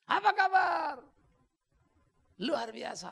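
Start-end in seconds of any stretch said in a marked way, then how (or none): 0.1-1.0 high, loud call to the crowd; rally leader shout. Retold or imitated. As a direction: pump up the crowd like a youth leader. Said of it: "anak-anak pimpin K K R".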